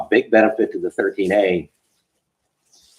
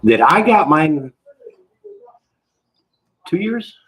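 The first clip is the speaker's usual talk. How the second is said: talking through a big mouthful; wolfing down food; 9 semitones higher, 4 dB louder, slower than usual. Real, not acted. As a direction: mouth full of breakfast. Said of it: "let me finish the one".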